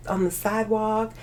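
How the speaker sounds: ordinary delivery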